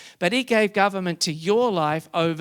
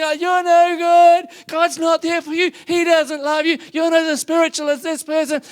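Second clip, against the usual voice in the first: screaming accusing voices; 10 semitones higher, 6 dB louder than usual. Acted. Pastor voicing the inner voices that scream at believers, high mocking shriek. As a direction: shriek the lies like a tormentor. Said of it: "They're screaming".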